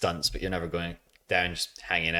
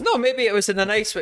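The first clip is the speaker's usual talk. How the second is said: teasing; 12 semitones higher, 4 dB louder than usual. Real, not acted. Teasing suggestion.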